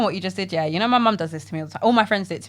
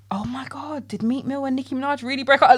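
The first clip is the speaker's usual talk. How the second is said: higher, hushed opening gasp; mock gossipy shock; 5 semitones higher, 4 dB quieter than usual. Imitating friend asking about celebrity breakup, scandalised.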